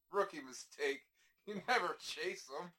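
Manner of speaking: chuckling through the scornful advice; mock the rookie while laughing. Mocking neighbor, laughing.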